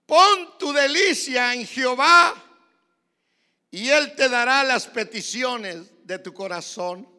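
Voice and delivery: high, very loud, slow preaching shout; loud, drawn-out pulpit proclamation; full-force scripture proclamation. Real, not acted. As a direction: thunder the verse out, stretching the words.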